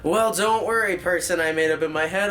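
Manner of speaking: usual speaking voice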